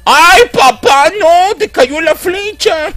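very high, drawn-out cartoon cry; hammed-up falsetto wail, loud and slow; mock anguished wail. A child cries out to papá as the arrow hits, in a mock anguished wail.

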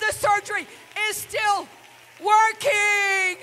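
high loud shouted testimony; preaching at full force. Acted. Preacher testifying to the congregation, full shout. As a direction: preach it with fire.

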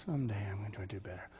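unvoiced sighing whisper; whispered lament. Preacher voicing a weary believer's sigh, whispered.

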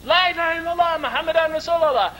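high, sustained fervent cry; retold group shout. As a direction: shout the creed as one united crowd. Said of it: "Again we shouted it".

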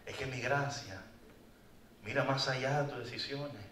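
soft, gentle, fading to a whisper; tender reassurance. Pretend. God to the lost one, soft and tender. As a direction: comfort him quietly.

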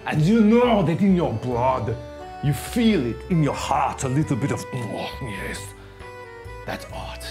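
hammed-up French art host accent; fake French accent. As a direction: play a pompous French artist, thick mock accent. Hosts in a put-on French accent.

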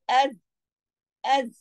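अज़ with the z settled and held; firm za. Shown as a demonstration. Teacher drills one letter, अज़ with a firm z. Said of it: "इसमें जमाओ होना चाहिए".

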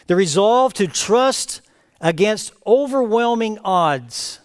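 normal talking voice

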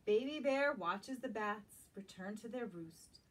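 gentle, lilting storybook read-aloud; reading to children. Acted. Teacher reading a picture book to young children, lilting. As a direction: draw little listeners into the story.

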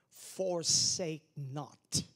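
very slow, heavy Forsake not; drawn-out scripture. Acted. Pastor quoting scripture to the church, drawn out. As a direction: stretch each word out.

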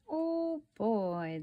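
ordinary delivery

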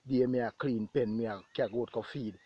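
normal talking voice

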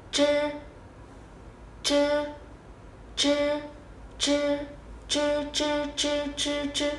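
âm chư không bật hơi, lưỡi cong; "chư" tròn miệng cong lưỡi, không bật hơi; tiếng Trung zh. Shown as a demonstration. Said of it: "đọc cùng em nào". (phát âm "chư" kiểu zh không bật hơi)

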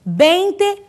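vinte said slowly, ending in a clear E; slow teacher's demo of "Vinte" with open-mouthed final e; final E sound. Shown as a demonstration. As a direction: stretch "Vinte" out, closing on a pure e. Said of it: "termina com E".

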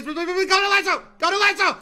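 loud, high pleading command; excited gamer yelling; frantic urging. Real, not acted. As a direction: shout it as if willing it to work. Frantic command during the catch.

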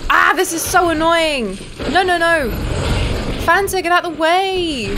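high loud exasperated shouts; shouting at a boss and a teammate; frustrated yelling. Real, not acted. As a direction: yell in frustration. Fighting hard enemy, frustrated.

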